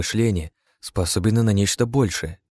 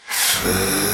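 deep echoing underworld god. A narrator's reading. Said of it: "прошелестел голос из загробного мира".

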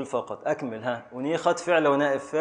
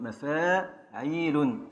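slow scansion; 4 dB quieter, much slower than usual. Shown as a demonstration. Teacher spelling out the metre for students, slow and drawn out. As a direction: stretch مفاعيل out slowly, syllable by syllable. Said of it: "فأل أدي الساكن الأول".

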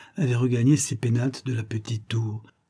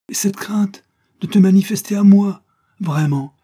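raised, much louder protest; indignant reproach; 8 semitones higher, 7 dB louder than usual. Acted. Lover reproaching her for hiding herself, indignant. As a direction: press the reproach, high and forceful.

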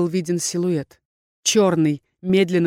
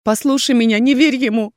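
louder, higher, hopeless begging; desperate plea; 5 semitones higher, 5 dB louder than usual. A narrator's reading. Heroine begging Erkhan not to believe him, despairing. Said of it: "Безысходность зазвенела в моём голосе".

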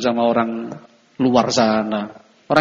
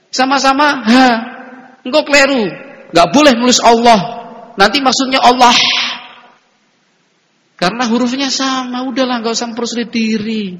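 mock pedant; 9 semitones higher, 5 dB louder than usual. Mocking pedants who correct spelling.

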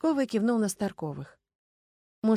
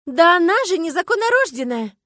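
high, loud, scornful accusation; outraged cry; 10 semitones higher, 11 dB louder than usual. A narrator's reading. Starkova objecting that Anfisa cannot be heir, outraged shout. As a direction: burst out in outrage. Starkova protests heir's claim.

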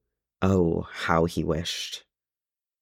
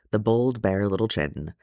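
ordinary delivery

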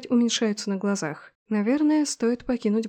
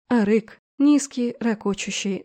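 usual speaking voice

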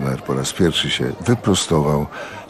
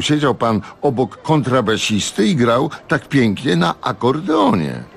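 higher, innocent bewildered lady; lightly voiced puzzled wife; 6 semitones higher than usual. A narrator's reading. Lindy asking Jan if she offended him, higher and innocent. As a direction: ask with wide-eyed innocence.

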